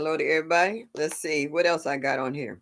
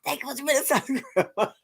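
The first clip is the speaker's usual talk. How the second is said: laughing quote; 8 semitones higher, much faster than usual. Retold or imitated. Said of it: "I was like".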